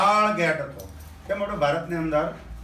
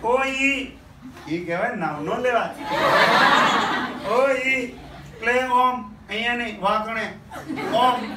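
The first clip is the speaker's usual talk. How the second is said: lightly voiced wife; 5 semitones higher, 4 dB louder, slower than usual. Imitating wife's dialect reply.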